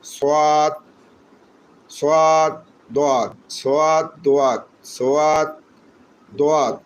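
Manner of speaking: "সাদ" held long and level, "দোয়াত" falling; stretched letter names "সাদ, দোয়াত" in alternating rise and fall; repeat-after-me drill. Shown as a demonstration. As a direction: slowly chant "সাদ, দোয়াত" for learners to repeat. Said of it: "আমার সাথে উচ্চারণ করেন".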